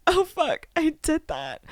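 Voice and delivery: high, dawning oh-no voice; put-on high voice of regret; mock shocked realisation. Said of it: "read his mind saying".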